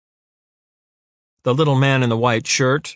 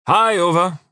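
bright, higher, louder greeting; cheerful call; 8 semitones higher, 5 dB louder than usual. A narrator's reading. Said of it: "he called out cheerfully".

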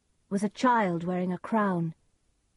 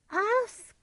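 much higher witch croak; 3 dB louder than usual. A narrator's reading. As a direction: cackle it out like a crone. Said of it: "said the second witch".

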